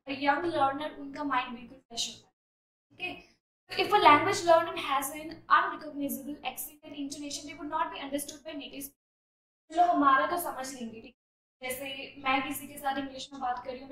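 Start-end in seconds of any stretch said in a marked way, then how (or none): 3.7-9.7 slow, deliberate read-aloud; measured classroom reading; slow essay reading. Shown as a demonstration. Reads essay sentence.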